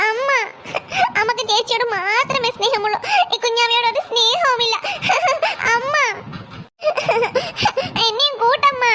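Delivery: whiny play-acted sobbing; crying child. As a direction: sob between phrases, pleading to be taken along. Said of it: "നീ ഇങ്ങനെ കരയണത്". (കരഞ്ഞുകൊണ്ട് കെഞ്ചുന്ന കുട്ടി)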